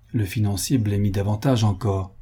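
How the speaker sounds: ordinary delivery, nothing marked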